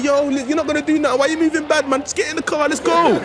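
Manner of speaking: high, quick nagging voice; scolding girlfriend. Retold or imitated. Girlfriend ordering her man into the car, scolding.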